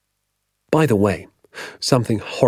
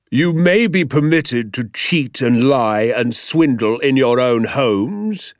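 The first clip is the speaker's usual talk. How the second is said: slow, raised, indignant lecture; pompous angry schoolmaster, drawn out; stern tirade; 4 semitones higher, 4 dB louder, slower than usual. Acted. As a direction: hammer out each offence. Teacher lectures class angrily.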